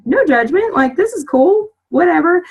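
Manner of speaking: high, mock-breezy surprise; surprised acceptance. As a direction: act unfazed by the preacher in jeans. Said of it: "And I'm like".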